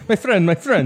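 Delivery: mock Indian accent. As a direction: say "my friend" in a hammed-up Indian accent. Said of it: "That's all I do for Indian".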